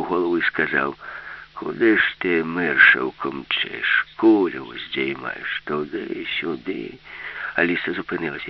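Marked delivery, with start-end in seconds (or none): 1.5-6.9 put-on slow, low grumbling old man; drawn-out, low old-timer grumble; grumpy old grandpa. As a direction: scold a child as a cranky old man. Grandpa scolds passing girl.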